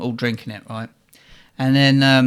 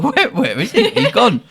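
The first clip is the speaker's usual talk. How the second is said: high-pitched, giggly bewilderment; retold baffled question, breaking into laughter; laughing disbelief; 7 semitones higher than usual. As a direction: laugh through the retold search. Laughing search for missing friend.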